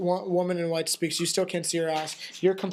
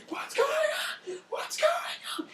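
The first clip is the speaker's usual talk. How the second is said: very high, drawn-out squealing gnome; panicked gnome; slower than usual. Acted. Game master voices a gnome startled by the explosion, squealing high. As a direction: squeal it like a frantic little gnome. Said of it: "you hear the gnome's voice going".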